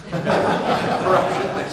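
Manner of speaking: breathy laugh on the word Corruption. Real, not acted. Audience joke, speaker laughs.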